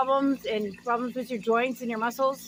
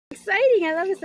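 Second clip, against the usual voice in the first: excited; 6 semitones higher, 4 dB louder than usual. Real, not acted. Thrilled by card message.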